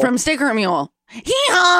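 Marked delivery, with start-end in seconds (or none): 1.1-1.8 donkey bray. Pretend. Donkey noise for Sticker Mule.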